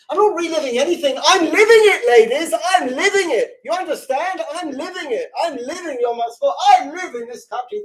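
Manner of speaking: high, forceful preaching with rising fervour; fervent, high-pitched proclamation; impassioned insistence. Real, not acted. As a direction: drive each declaration home, loud and high. Impassioned climax of her sermon.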